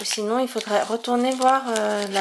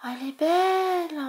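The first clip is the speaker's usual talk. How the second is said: admiring; 6 semitones higher than usual. Real, not acted. Admiring a new card.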